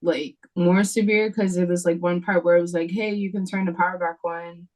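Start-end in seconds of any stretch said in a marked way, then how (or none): none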